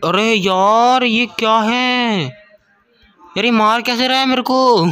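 high, drawn-out protest; real gamer's high-pitched exasperation; exasperated dismay. Real, not acted. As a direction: wail in disbelief at being hit. Dismay as game enemy hits him.

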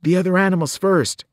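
earnest Grover. A narrator's reading. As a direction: insist kindly but firmly. Grover insisting.

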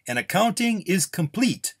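high-pitched public proclamation; announcement. A narrator's reading. Official opens the contest results, announcing. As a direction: proclaim it to the whole crowd.

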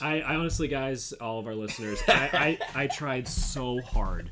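real laughter over a dropped accent; laughing. Real, not acted. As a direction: crack up while admitting defeat.

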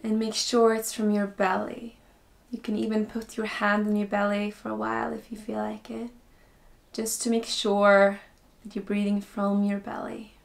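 slow, calm meditation voice with long pauses; guided meditation. Acted. Teacher guiding beginners into breathing and stillness, slow guided meditation. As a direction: ease them in slowly, softening as phrases end.

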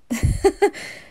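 high breathy giggle; amused giggle. Real, not acted. Laughing at baby.

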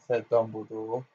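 ordinary delivery

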